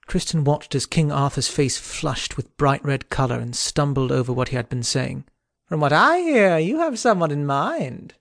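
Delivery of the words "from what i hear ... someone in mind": higher sly knight voice, grinning; teasing Kay. Acted. Sir Kay ribbing Arthur about his lady, high and sly. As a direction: lean in with a knowing tease. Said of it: "Kay carried on".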